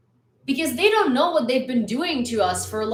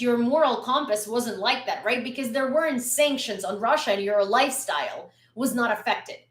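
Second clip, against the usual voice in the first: normal talking voice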